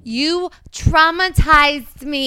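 Jersey accent, slow and high on traumatized; drawn-out Jersey girl whine. Shown as a demonstration. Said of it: "I go in. This is how I get into it".

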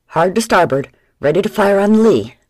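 pirate shouting orders at the wheel; shouted orders. Acted. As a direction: bellow over the noise of battle. Pirate orders crew in battle.

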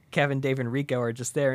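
ordinary talk

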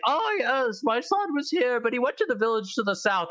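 high, put-on game character voice; retold castle character. Parent sending the player to the village, high mock voice. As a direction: imitate the parent at the castle, pitched well up. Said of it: "and they're like".

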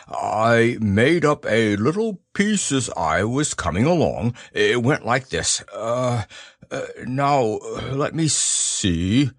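a lightly voiced slow, hesitant Pooh Bear. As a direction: dawdle through it like a thoughtful bear of little brain.

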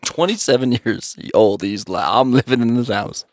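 low mock voice. Mocking Kev in low voice.

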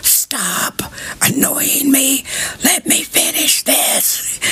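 high, slow whispered scolding; playful whisper in place of a yell. Shown as a demonstration. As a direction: hiss it softly at the cat. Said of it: "the equivalent of me yelling at them".

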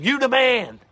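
high-pitched golf heckler's yell; mock fan yell. Imitating people yelling at golf, mock yell. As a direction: holler it like a fan in the gallery. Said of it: "without people yelling".

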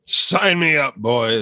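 growly pirate shout; hammed-up pirate. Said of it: "You sounded kinda like a pirate".

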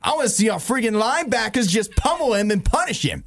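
high-pitched, hammed-up New York mafia guy; New York accent. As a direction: get right into a mad New York mobster. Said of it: "you get more into it".

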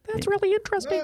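squeaky, very high helium voice. Pretend. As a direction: talk like you just sucked helium. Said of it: "I just had, uh, some helium there".

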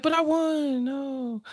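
high, whimpering little protest; hammed-up childish plea; mock protesting Harvey. Harvey protests again after scolding.

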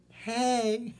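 high put-on girly voice; girl voice.

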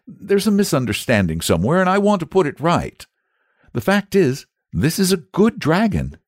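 put-on high, earnest young boy. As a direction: explain it earnestly. Boy explaining to saint.

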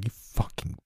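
slow, whispered insult; muttered curse. Acted. John whispering an insult at the captive girl. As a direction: spit it out under your breath. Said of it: "John was muttering".

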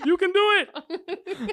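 high, slow, laughing through a mock pep talk; laughing mock encouragement. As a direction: squeak it out slowly while laughing.

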